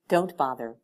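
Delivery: authoritative. A narrator's reading. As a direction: cut her off with authority. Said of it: "Helena said authoritatively".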